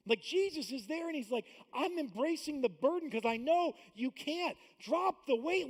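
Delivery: high, loud, driving sermon voice; full-force preaching. Acted. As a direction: preach it hard to the congregation.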